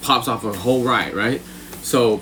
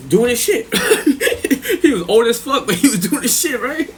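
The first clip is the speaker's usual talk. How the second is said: high, through bursts of laughter; laughing while talking; 9 semitones higher than usual. Real, not acted. Inmate telling chat about old V's game, laughing. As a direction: crack up as you say it. Cracking up at his own story.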